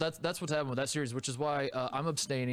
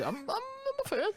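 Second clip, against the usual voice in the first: much higher, slow, stammering squeal; high squeaky fan voice. Shown as a demonstration. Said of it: "You have to say".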